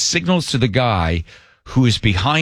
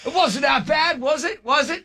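much higher, softer needling voice; teasing operator; 14 semitones higher, 3 dB quieter than usual. Retold or imitated. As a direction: needle him, sing-song high. Operator teasing after the drop.

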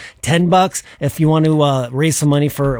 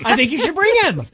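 teasing squeal; 12 semitones higher than usual. Real, not acted. Teasing, urging to bring dog.

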